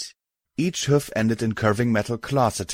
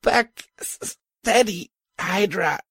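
much higher, weak coughed words; coughing; 11 semitones higher than usual. Acted. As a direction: choke it out between coughs. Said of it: "I coughed".

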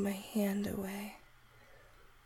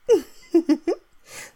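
delighted laugh; 13 semitones higher, 13 dB louder than usual. Acted. Bursting laugh in roleplay.

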